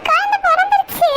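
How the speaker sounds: high, overjoyed cry of news; excited. Acted. Relative hears the newborn cry and exclaims, excited and high. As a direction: burst out with joy that the baby is here. Baby born, family waiting outside.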